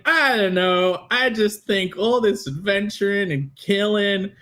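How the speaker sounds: a hint of a sad young hero; mild wistful boy; lightly voiced pensive Connell. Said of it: "he's like".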